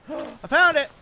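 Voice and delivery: high, loud gleeful cry; excited. Real, not acted. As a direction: cheer it out. Finds the page.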